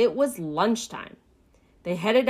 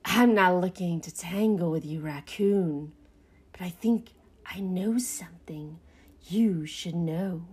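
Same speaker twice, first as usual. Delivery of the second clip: shady rat. A narrator's reading. Rat offers Pinky a secret, sly and hushed.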